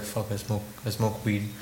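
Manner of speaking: very soft, low admission; retold reluctant confession. Son admitting to dad behind a closed door, hushed reluctant confession. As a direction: confess it under your breath.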